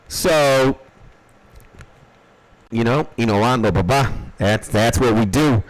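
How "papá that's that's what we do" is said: normal talking voice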